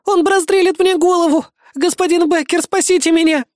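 very high, loud wail; hysterical, tearful woman crying out; breaking into sobs. A narrator's reading. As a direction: wail for help in terror. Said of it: "не сдержалась и зарыдала".

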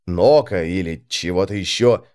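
usual speaking voice

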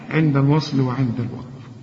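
normal talking voice

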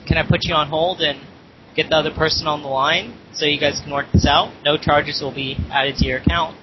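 normal talking voice

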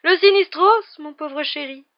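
high, loud, theatrical cry of doom; horrified exclamation. A narrator's reading. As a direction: cry it out, drawing out the last word. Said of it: "s'écria le professeur Trelawney".

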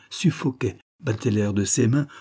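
ordinary delivery

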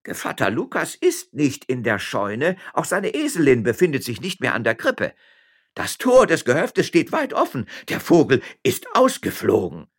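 high-pitched, alarmed servant; breathless servant reporting. Acted.

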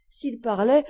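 plain reading voice

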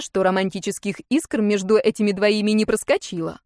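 plain reading voice

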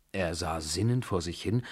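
ordinary delivery, nothing marked

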